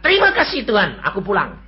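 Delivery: high, loud thankful outburst; retold joyful prayer; joyful cry to God. Praying to die, grateful.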